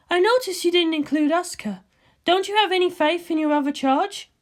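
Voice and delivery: a cool scientist ribbing her friend; Ritsuko's voice. Acted.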